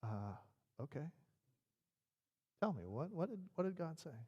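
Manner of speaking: soft, halting, reluctant question; hesitant Saul. Acted. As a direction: stammer it softly, unsure you want the answer. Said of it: "I wonder if it's like".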